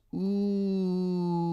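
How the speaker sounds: long, high, steady "ooh"; crowd's ooh. A narrator's reading. Crowd watching the fireworks, a long high ooh. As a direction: draw out an awed ooh in a high voice. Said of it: "and everybody goes".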